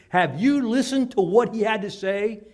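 loud, high retort; guards retorting. Acted. Temple guards retorting to the priests, loud and high. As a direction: throw the question right back.

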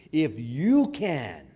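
"If you can" very slow, loud swell on "you"; pointed rebuke. Acted. Lord answering the doubting father, "If you can" as pointed rebuke. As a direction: turn "If you can" back with drawn-out weight on "you". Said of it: "turns it right back around".

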